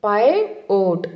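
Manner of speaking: "పైవోట్" slowly as PIE... VOTE; careful, split "పైవోట్", pie then vote; model pronunciation. Shown as a demonstration. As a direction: sound out "పైవోట్" in two slow beats. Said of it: "అని పలకాలి".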